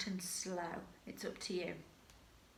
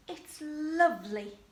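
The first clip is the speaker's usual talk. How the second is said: higher, dreamy sigh of a voice; Cinderella's delight; 6 semitones higher than usual. Shown as a demonstration. Teacher voices Cinderella at the ball, higher and dreamy. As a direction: marvel like a princess at the ball. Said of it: "she will say".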